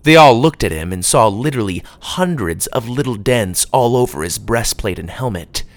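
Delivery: usual speaking voice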